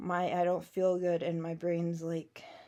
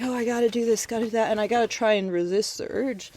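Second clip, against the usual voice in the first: hurried inner voice; 3 semitones higher, 7 dB louder, faster than usual. Retold or imitated. Frantic inner voice, rushing thoughts.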